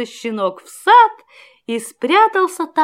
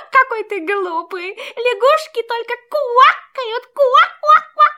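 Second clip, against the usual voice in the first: laughing frog voice; 9 semitones higher than usual. A narrator's reading. Mocking frog speaks.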